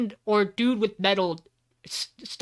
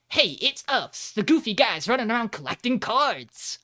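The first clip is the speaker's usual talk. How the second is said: mock cartoon hero; 5 semitones higher, 3 dB louder than usual. Parodying a Saturday morning cartoon, goofy higher hero voice. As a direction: sell it like a cheesy Saturday cartoon. Mocking a kids' cartoon intro.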